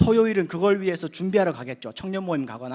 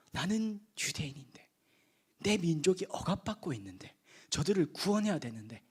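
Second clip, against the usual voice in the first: hushed, breathy inner thought, much softer; inner whisper; 8 dB quieter than usual. Acted. Preacher voicing Moses' secret burden to the congregation, hushed whisper. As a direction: murmur it to yourself like a secret burden.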